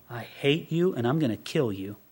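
low, soft menacing aside; quiet, acted secret threat; hidden hatred. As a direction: think it darkly under a friendly front. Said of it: "All the while thinking".

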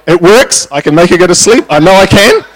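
high, loud retold protest; insistent self-defence. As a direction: argue your case emphatically, pitched right up. Acting out argument with wife.

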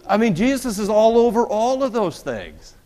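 high, loud, laughing through the words; real laughter breaking through a sermon point. Real, not acted. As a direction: laugh it off at the tough crowd. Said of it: "Man, you are tough today".

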